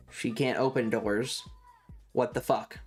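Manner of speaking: ordinary talk, nothing marked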